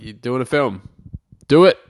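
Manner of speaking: mock forceful shout; barked order. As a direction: act out a boss ordering him. Studio ordering him into film.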